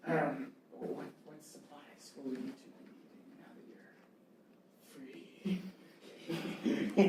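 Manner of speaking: whispery NPC asking politely; Yanti voice. Acted. Yanti NPC asking freed prisoners about supplies, hushed.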